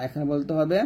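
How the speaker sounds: ordinary delivery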